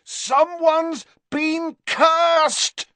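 slow, high full bellow. A narrator's reading. Harry to Hagrid over raging wind, bellowing. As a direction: bellow each word over the gale. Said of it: "bellowed Harry".

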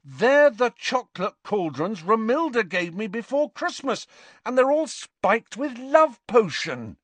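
very high, urgent, exasperated tirade; heated exasperation. A narrator's reading. Harry explains the love potion to Ron, exasperated. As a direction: pile up the facts in high-pitched exasperation.

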